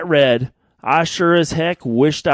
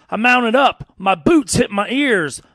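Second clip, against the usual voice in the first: ordinary talk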